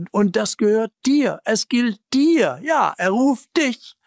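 high, urgent preaching voice; fervent direct appeal, pitched well up; impassioned appeal. Real, not acted.

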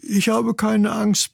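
high, slow bragging chick; boasting. Acted. Brother chick to his scared sister, boasting. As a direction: show off with drawn-out bravado. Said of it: "prahlte ihr Bruder".

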